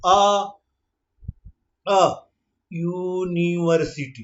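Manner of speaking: slow, the opening vowel drawn out; slow motion. Shown as a demonstration. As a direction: stretch the start slowly. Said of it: "देखिए इसकी शुरुआत देखिए".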